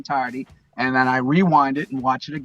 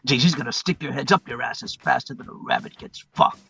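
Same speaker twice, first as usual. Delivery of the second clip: movie gangster monologue; 2 semitones higher than usual. Retold or imitated. Drug boss talks to a newcomer fresh from Cuba, gangster impression. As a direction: play the crime boss sizing up and briefing a newcomer. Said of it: "And he's talking to him".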